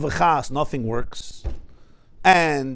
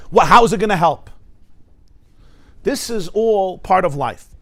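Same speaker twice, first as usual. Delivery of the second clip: ordinary talk, nothing marked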